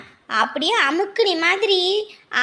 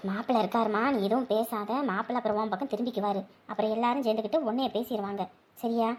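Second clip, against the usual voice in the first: low, soft, quick caution; hushed warning; 8 semitones lower, 8 dB quieter, faster than usual. Acted. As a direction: confidentially talk them into keeping quiet. Hushed advice not to speak up.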